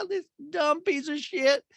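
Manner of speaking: hammed-up falsetto berating mother; Patricia. Mother insulting her son's gray shirt, high gleeful scolding. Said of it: "And she goes".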